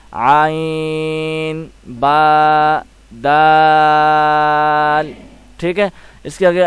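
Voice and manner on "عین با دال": عین and دال held very long on one note, با shorter; long madd recitation. Shown as a demonstration. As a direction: chant عین، با، دال slowly, stretching first and last. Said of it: "ہم نے دال کو لمبا اور کھینچ کے پڑھنا ہے".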